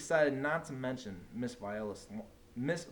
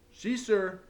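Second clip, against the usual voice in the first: put-on higher, louder eager kids answering; 9 semitones higher, 8 dB louder than usual. A narrator's reading. Kids covering for the silent teacher, eager. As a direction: blurt it out for her.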